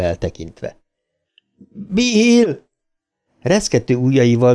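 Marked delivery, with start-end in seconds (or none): 1.9-2.6 high, drawn-out cry of a name; high falling wail; desperate call. A narrator's reading. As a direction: call out into silence for a friend. Desperate call, tears choking him.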